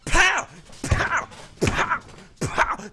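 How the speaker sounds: loud high pow impacts; chain blow noises. Retold or imitated. Acting out the chain beating, loud high pows. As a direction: shout out each hit.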